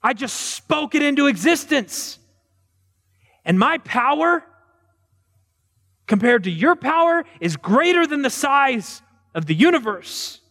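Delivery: high, proud God voice, holding the word power; God declaring his power like a proud boast; booming God. Acted. Preacher voicing God boasting to the crowd about his might. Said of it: "And God says".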